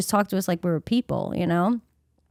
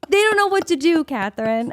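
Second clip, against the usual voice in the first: imitating mom; 8 semitones higher than usual. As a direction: play mom with a lightly voiced scolding lift. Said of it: "She's like".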